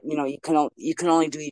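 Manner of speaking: ordinary talk, nothing marked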